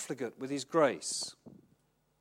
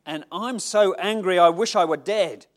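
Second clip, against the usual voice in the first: angry Jonah; 2 semitones higher, 10 dB louder than usual. Acted. Jonah snapping back at God, loud and angry. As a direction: let Jonah's fury burst out.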